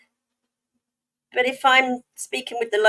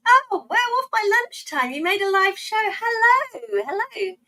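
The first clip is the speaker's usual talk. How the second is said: high-pitched, excited hello to a newcomer; thrilled, sing-out welcome; delighted greeting; 11 semitones higher, 3 dB louder than usual. Real, not acted. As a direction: greet them with a burst of surprise. Greeting a viewer who just arrived.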